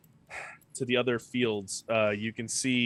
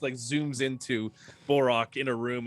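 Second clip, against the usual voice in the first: normal talking voice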